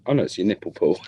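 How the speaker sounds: ordinary delivery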